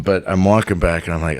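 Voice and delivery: usual speaking voice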